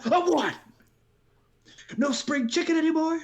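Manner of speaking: put-on a proud old dad who won't be written off. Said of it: "he's like".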